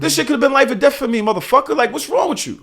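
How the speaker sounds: high, indignant complaint; exasperated retold scolding. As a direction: act out telling the driver off. Retells scolding the driver.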